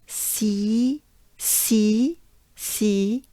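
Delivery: "si" with a long, wide-smile ee; drawn-out French ee in each "si"; banana-smile E. Shown as a demonstration. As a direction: stretch "si" through a banana smile. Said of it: "we have a banana smile".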